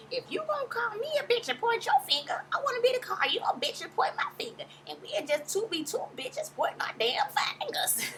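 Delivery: high mock Mama Joyce voice. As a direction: squeak it like a petty old lady. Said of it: "And that voice drives me crazy".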